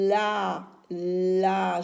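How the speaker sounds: "la" with a long L and a held A; syllable sounded out. Shown as a demonstration. Teacher helping children hear the A, "la" stretched out. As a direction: stretch "la" so the A is clearly heard. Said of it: "J'entends A".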